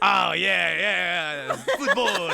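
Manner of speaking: loud, high mock Latin-language accent. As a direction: ham up a European student's Latin accent. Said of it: "Is that your Spanish accent?".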